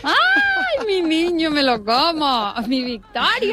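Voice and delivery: high, drawn-out affectionate squeal; gushing delight. Real, not acted. Guest hears her friend wrote in, gushing delight. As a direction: coo adoringly, starting very high and easing down. Thrilled to hear friend's name.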